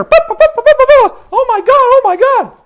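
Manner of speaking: high, loud Muppet bleating in panic; high loud panicky Beaker babble; Beaker impression. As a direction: bleat frantically like Beaker mocking critics. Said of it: "like Beaker".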